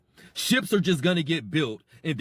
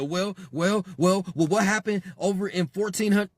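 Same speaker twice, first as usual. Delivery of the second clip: mock stammering deflection; 4 semitones higher than usual. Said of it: "Everybody starts stuttering".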